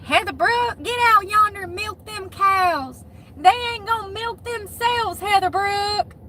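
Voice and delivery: high, hollering country mom voice; Southern parent calling. Retold or imitated. A parent calling her daughter out to milk cows, high and hollering. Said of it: "You sound like me".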